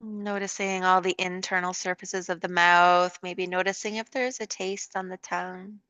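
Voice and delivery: calm, unhurried yoga guidance with long pauses; guided meditation. Real, not acted. Leads a yoga relaxation.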